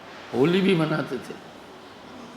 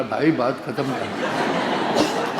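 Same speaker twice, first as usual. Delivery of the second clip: lower, slow parroted plea; imitating little brother's echo; 4 semitones lower, much slower than usual. Little brother aping elder brother, slow low echo. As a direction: drag out the copied words. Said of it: "acting भी वैसे ही कर रहा था".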